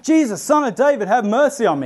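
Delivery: high, pleading call across a crowd. Retold or imitated. Blind beggar calling to Jesus over the crowd, high call. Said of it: "he starts calling out".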